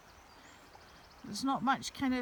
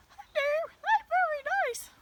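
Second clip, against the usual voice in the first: very high, loud cartoon dog voice; dogs' imagined tone; 6 dB louder than usual. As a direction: pretend to be a talking dog, squeaky high. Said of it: "that's kind of more their tone".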